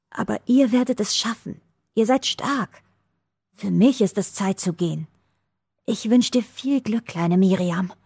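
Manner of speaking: a hint of a frail old woman pleading; dying old woman. A narrator's reading. Dying woman makes the girl promise, a lightly voiced plea.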